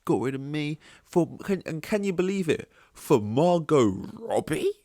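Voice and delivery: high, mock-indignant whine of disbelief; awkward indignant quote. Mocking a rejected actress who can't believe who replaced her. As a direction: overdo the outrage, voice rising high. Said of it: "said it in, like, a awkward way".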